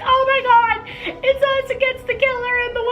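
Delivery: very high, far-off terrified squeal; panicked daughter. Retold or imitated.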